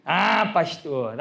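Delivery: slow, drawn-out teasing; mocking. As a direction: imitate a friend making fun, stretching the words. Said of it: "ele me zoava".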